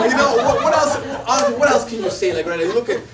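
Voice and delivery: exasperated, still laughing; laughing exasperation. Real, not acted. Asking the listener what else he could say, laughing.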